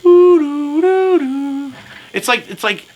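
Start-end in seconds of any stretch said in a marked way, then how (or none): 0.0-1.7 high light tooting noise; high toy-like tooting. Retold or imitated. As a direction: toot it high like a little toy. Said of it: "it should be like".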